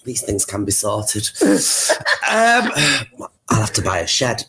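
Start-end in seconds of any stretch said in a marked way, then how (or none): none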